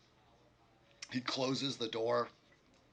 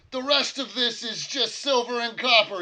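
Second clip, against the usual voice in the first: higher, louder gruff barkeep voice; innkeeper voice; 12 semitones higher, 12 dB louder than usual. Acted. Innkeeper telling the party their silver is worthless, loud and higher. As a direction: play the innkeeper sizing up their coins. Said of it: "and says".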